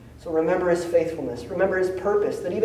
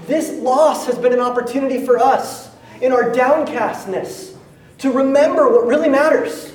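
rising preaching; 5 semitones higher, 4 dB louder than usual. Real, not acted. Preacher voicing sister's message, climax.

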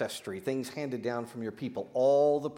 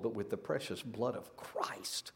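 hushed whisper; 4 semitones lower, 9 dB quieter than usual. Real, not acted. Preacher whispering scripture to the congregation, hushed and slow. Reverent climax of scripture reading.

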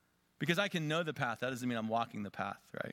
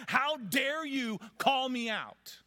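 high, slow, mocking holier-than-thou voice; mock sanctimonious; 13 semitones higher, 5 dB louder, slower than usual. Preacher mocking the self-righteous crowd, high and drawn out. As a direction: sneer it like a prig, pitched way up.

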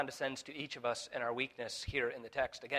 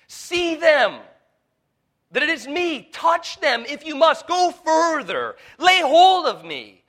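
very high, very loud, slowing to a cry; impassioned Jesus; 14 semitones higher, 18 dB louder, slower than usual. Acted. Preacher as Jesus begging the disciples to touch his wounds, shouted. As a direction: cry out the invitation, stretching the last words. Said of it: "What's he asking them to look at?".

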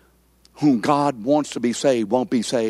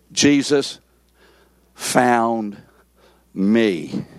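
each word set apart by long pauses; "Jesus ... found ... me", deliberate and stepping down; word-by-word correction; much slower than usual. Shown as a demonstration. Preacher correcting the congregation's wording, slow and emphatic. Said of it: "And say what?".

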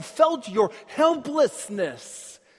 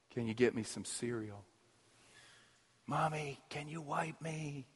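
a soft, lightly voiced little child pleading for Mommy; small child asking for help; 10 semitones lower, 12 dB quieter than usual. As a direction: softly imitate a scared child calling to its parents. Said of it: "They sound like this".

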